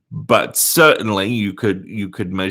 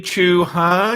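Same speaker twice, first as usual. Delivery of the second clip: normal talking voice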